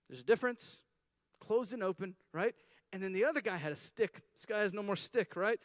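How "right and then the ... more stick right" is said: ordinary talk, nothing marked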